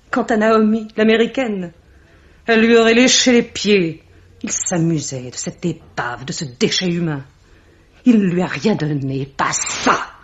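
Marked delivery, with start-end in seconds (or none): 0.1-4.0 scornful outburst. Acted. Scornful outburst about Naomi.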